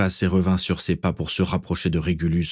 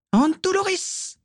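slow, very high shrieked spell; frenzied curse; slower than usual. A narrator's reading. Bellatrix, unleashed, curses again and again, long high shriek. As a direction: screech it out, drawn long. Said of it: "Bellatrix était déchaînée".

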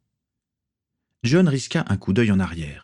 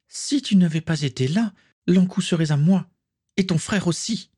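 higher, resentful voiced Tatiana; bitter villainess; 11 semitones higher than usual. Tatiana blaming Swazik, higher bitter voice. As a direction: spit the blame at her rival.

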